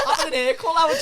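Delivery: words squeezed out through high laughter; cracking up at the tease; laughing. Real, not acted. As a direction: laugh while you answer. Laughing after being teased.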